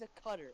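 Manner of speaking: puzzled at Qatar said "CUT-er"; Qatar mocked as "cutter". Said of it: "not something you cut with".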